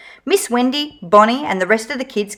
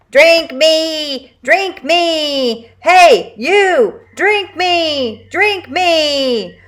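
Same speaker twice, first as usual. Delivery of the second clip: higher, repeated pleading chant; insistent juice box robot; 6 semitones higher, 3 dB louder, much slower than usual. A narrator's reading. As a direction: keep begging, pushier each time.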